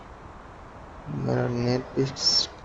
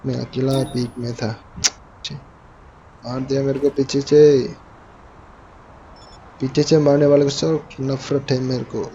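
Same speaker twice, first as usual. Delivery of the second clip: ordinary talk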